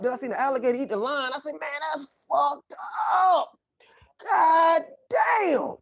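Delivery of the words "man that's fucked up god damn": mock crying shout. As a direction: cry out in hammed-up shock. Shocked at alligator eating lion.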